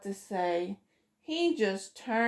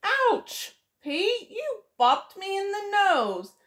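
Gus yelps ouch, much higher; pained squeak after a bop on the nose; hurt Gus; 10 semitones higher than usual. Acted. Gus telling Pete he bopped his nose, yelping high.

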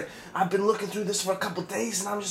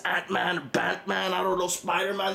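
ordinary talk, nothing marked

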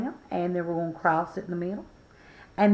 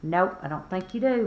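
ordinary talk

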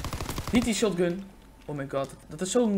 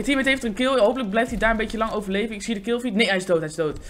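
ordinary talk

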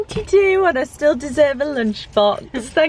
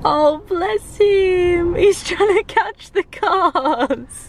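higher and slow, melting over the kitten; 6 semitones higher, much slower than usual. Real, not acted. Cooing at the new kitten.

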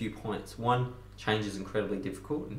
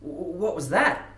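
high, stammered shock; startled; 8 semitones higher, 5 dB louder, slower than usual. Acted. Frog has just been stung by scorpion, acted startle.

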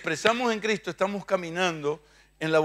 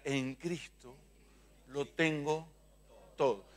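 quiet repeat-after-me; 3 semitones lower, 10 dB quieter, slower than usual. Shown as a demonstration.

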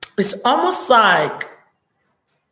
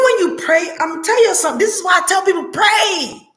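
fervent preaching; 9 semitones higher, faster than usual. Real, not acted. Preacher urging viewers to pray, voice rising to a shout. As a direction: urge them to pray, voice climbing.

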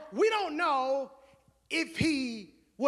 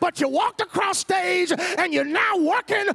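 loud, high, building sermon shout; preaching at full force; 6 semitones higher, 8 dB louder than usual. Acted. Preacher urging the church to nudge a neighbor, shouting.

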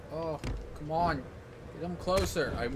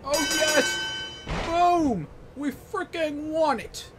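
very high, loud triumphant cheering; victory whoop; 11 semitones higher, 6 dB louder, slower than usual. Real, not acted. Player cheering after winning his match, loud triumphant whoop. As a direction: celebrate the win at full volume.

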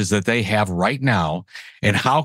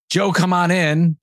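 higher, eager client voice piling on questions; a mock-eager company boss, pitched up; eager corporate client; 8 semitones higher than usual. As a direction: play the excited exec begging for a big idea. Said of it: "And they said".

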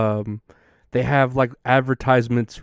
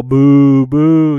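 crowd booing; 3 semitones higher, 10 dB louder than usual. Retold or imitated. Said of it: "they're all".

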